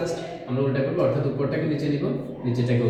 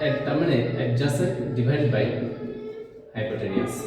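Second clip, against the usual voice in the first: very slow, word by word; slow dictation; much slower than usual. Shown as a demonstration. Teacher giving the formula for copying, slowly. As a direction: let every word land for note-taking.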